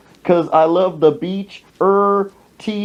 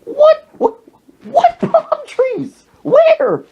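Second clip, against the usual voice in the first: very high, loud comic disbelief; hammed-up falsetto outburst; incredulous mock shriek; 4 dB louder, much slower than usual. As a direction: squawk it in exaggerated bafflement. Disbelief at host's palm trees claim.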